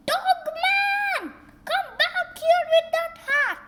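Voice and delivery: high, loud furious yell; angry shout. Acted. Mayor yelling at Dogman running off with his hat. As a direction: bellow after the fleeing dog.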